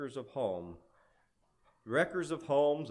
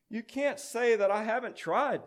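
higher, aggrieved pout; 10 semitones higher, 4 dB louder than usual. A narrator's reading. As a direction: protest like someone unfairly blamed. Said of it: "pouted Anger".

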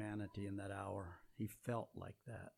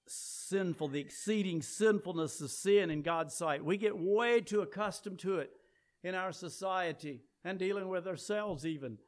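loud, ringing pulpit preaching; full-force preaching; 8 semitones higher, 8 dB louder than usual. Acted. Preacher warning the congregation about sin, loud and fervent preaching.

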